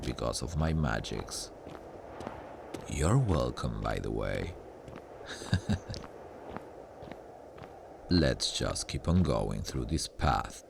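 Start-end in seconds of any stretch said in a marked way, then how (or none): 4.6-7.1 breathy, quiet wicked chuckle; soft evil chuckle. Acted. Demon gloats to the listener about the stabbed friend, soft evil chuckle. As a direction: chuckle under your breath, pleased. Demon after "you're welcome.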